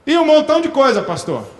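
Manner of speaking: shameless churchgoer boasting his sins; cheeky believer. Retold or imitated. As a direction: brazen believer owning up with a grin. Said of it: "crente cara de pau".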